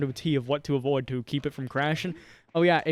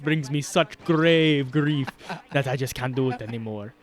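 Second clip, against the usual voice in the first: mock grave grief. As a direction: stretch it out like a melodrama. Mock tragic speech.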